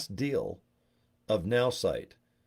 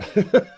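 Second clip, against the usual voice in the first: burst of laughter; 11 semitones higher, 9 dB louder than usual. Real, not acted. Laughing at the movie memory.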